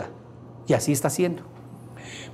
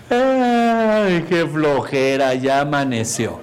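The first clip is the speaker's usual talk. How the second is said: mock lazy whine; much slower than usual. Waking up complaining of laziness.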